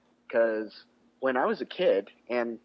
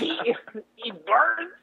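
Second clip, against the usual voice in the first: put-on Mexican accent; 11 semitones higher, slower than usual. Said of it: "in a Mexican accent?".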